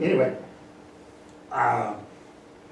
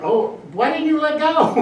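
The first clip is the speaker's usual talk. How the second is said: mock hawk voice; 14 semitones higher, 8 dB louder than usual. Hawks voiced after falling.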